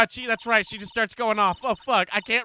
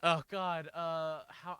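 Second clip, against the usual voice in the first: usual speaking voice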